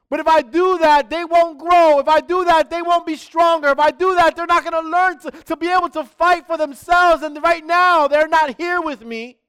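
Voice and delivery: loud, high preaching as God wishing aloud; lightly voiced God, torn and yearning; preacher voicing God's longing at full force. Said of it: "with this desire to say".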